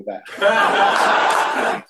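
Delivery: stretched out slowly, breaking into a laugh; laughing at the friend's reply, dragged out slowly. Retold or imitated. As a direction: laugh through the punchline.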